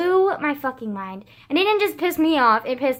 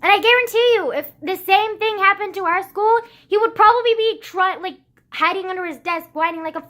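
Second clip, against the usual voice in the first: heated rant; 5 semitones higher, 4 dB louder than usual. Real, not acted. Angry rant about the classmate.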